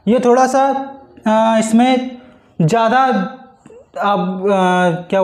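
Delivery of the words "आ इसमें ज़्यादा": ordinary delivery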